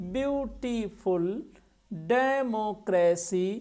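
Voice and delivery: "ब्यूटीफुल" and "डेमोक्रेसी" slowly broken into their syllables. Shown as a demonstration. Teacher showing longer words, slowly split syllable by syllable. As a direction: sound out "ब्यूटीफुल", "डेमोक्रेसी" one syllable at a time. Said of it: "दो से अधिक syllable वाले शब्द".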